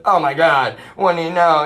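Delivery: high, loud mock indignation; mock outrage. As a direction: blurt it high, imitating an outraged relative. Mocking relatives' outraged reaction to truth.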